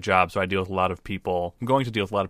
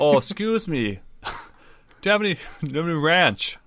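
high, polite Midwestern apology voice; polite Midwestern furbolg; 7 semitones higher than usual. Pretend. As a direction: ask for ranch like a meek Midwesterner. Voicing polite ranch-loving furbolg.

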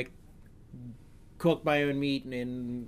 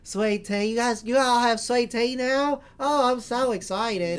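hammed-up Southern drawl on "Sweet tea", higher, louder and slower; southern accent; 11 semitones higher, 8 dB louder, slower than usual. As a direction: play a gushing Southern belle, "Sweet tea" stretched out slow. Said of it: "it gives you a southern accent".

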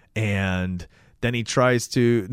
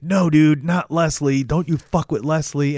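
higher, louder pleading warning; acted heated protest; 5 semitones higher, 5 dB louder than usual. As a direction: act out standing up for someone. Acted protest over threat to Leslie.

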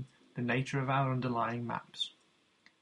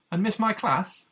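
much higher, louder, playful incredulous question; mock disbelief; 8 semitones higher, 7 dB louder than usual. A narrator's reading. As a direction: feign shock at the student's choice. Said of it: "I replied in mock disbelief".

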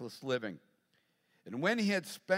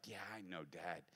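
much lower and much softer, sheepish; sheepish daughter; 8 semitones lower, 15 dB quieter than usual. Retold or imitated. Hungover daughter answers her dad's lesson, sheepish and quiet. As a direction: mumble it like a hungover teen. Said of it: "she's like".

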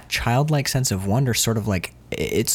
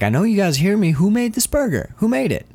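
shouting in a restaurant; 11 semitones higher than usual. Retold or imitated. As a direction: call out across the whole restaurant, much higher. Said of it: "he's a guy shouting in a restaurant".

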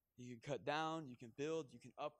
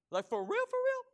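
squealed disbelief, much higher and louder; mock incredulous serpent; 10 dB louder than usual. Serpent mocking God's rule to Eve, squealing disbelief. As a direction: shriek for real in mock shock. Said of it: "and he goes".

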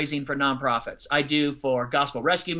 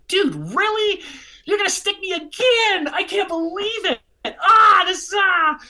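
high, loud, frustrated groan; exasperated disbelief; 12 semitones higher, 6 dB louder than usual. Retold or imitated. Watching the man line up again, exasperated. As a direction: dread him coming over. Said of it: "I was like".

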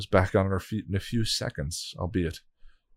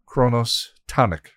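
chanted spell names, raised and deliberate; lightly intoned incantation; 6 semitones higher, 4 dB louder, slower than usual. Acted. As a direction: call each name like a spell. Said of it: "she incanted".

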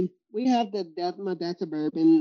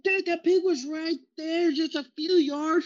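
indignant complaint; 10 semitones higher than usual. Retold or imitated. Retelling complaint to dad.

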